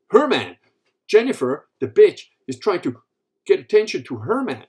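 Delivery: loud, high, emphatic outrage; acted territorial wife's complaint; jealous indignation. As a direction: play the possessive wife, punching each phrase. Voicing jealous wife's indignant thoughts.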